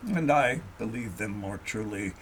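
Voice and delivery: ordinary delivery, nothing marked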